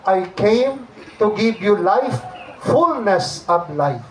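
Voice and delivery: slow, weighty reading of the verse; slow and reverent; solemn scripture quote. Acted. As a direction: let each word land slowly. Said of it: "Sabi nga in the Gospel of John".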